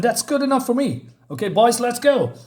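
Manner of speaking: high-pitched, decisive wrap-up; retold order, pitched well up; acted boss dismissal. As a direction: play a boss rounding up his men. Boss wraps up, orders men out.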